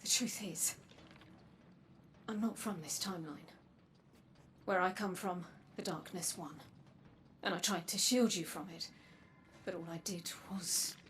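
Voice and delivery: quiet, halting confession with long pauses; soft confession. Acted. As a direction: confess it softly, piece by piece. Soft confession and apology to Anna.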